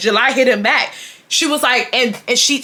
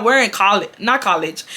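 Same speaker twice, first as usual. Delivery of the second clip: normal talking voice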